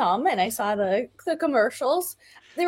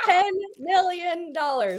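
TEN MILLION DOLLARS drawn out slowly, higher; incredulous emphasis; 5 semitones higher, slower than usual. Real, not acted. Repeating the shocking fee to a disbelieving co-host, slow emphasis on "Ten million dollars". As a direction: stretch out each word of "Ten million dollars".

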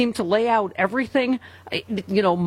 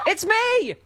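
high, playful holler; mock loud yell; 11 semitones higher than usual. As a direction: shout for attention in jest. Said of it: "It also expresses volume".

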